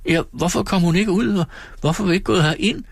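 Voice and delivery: put-on high, anxious little boy's voice; a scared child pressing for an answer; frightened child. A narrator's reading. Child David presses Peggotty. (høj, ængstelig drengestemme)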